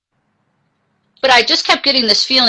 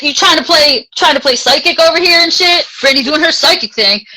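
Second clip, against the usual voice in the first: mocking sister; 6 semitones higher, 5 dB louder than usual. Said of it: "Christy's like".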